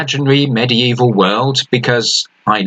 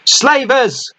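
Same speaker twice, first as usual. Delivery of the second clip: high, shrill scream of alarm. A narrator's reading. Lookout screaming a warning to the crew. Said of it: "the lookout screamed".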